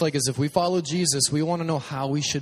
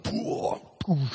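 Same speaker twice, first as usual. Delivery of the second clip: breathy puffs of effort heaving stones; effort grunts lifting rocks. Retold or imitated. Boy picking stones, mock effort puffs. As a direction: puff out like hoisting a heavy rock. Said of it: "you're like".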